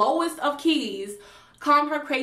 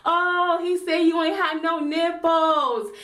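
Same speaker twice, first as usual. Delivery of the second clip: loud, high hype-man yell from the background; hammed-up crowd jeer, high and drawn; egging-on friends; 5 semitones higher, 3 dB louder than usual. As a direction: instigate like friends gassing up a fight. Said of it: "his friends were in the background, like".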